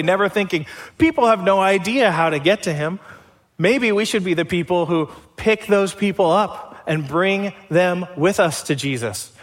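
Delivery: ordinary talk, nothing marked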